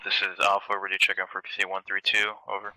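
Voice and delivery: loud, clear radio call over the net. Real, not acted.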